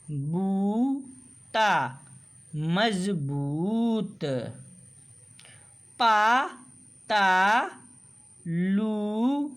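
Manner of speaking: sounding out each word slowly, syllable by syllable; slow, careful phonics reading; slow syllable drill. Shown as a demonstration. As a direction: read like a teacher spelling words for children.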